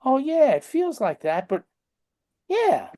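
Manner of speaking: high sing-song brush-off voice; mocking a skeptic who brushes it off; mock dismissive patient. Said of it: "And people go".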